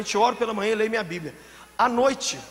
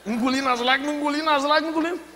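hammed-up struggle to hold tears in; mock choking back tears; 6 semitones higher, faster than usual. Preacher mimicking a woman swallowing her tears, mock-strained. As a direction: climb higher with each repeat, mock-straining.

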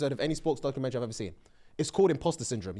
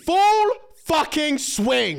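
slow, loud and high, every word hit; excited emphasis; 10 semitones higher, 6 dB louder, much slower than usual. Real, not acted. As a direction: really sell the show title.

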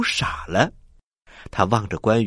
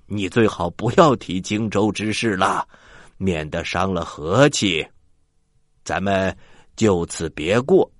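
pretend drunk; 6 semitones lower than usual. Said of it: "假装喝醉了".

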